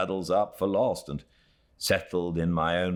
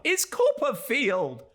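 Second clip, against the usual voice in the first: much higher, slow delighted exclamation; recognizing an old friend with glee; joyful cry. Acted. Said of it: "cried Traddles".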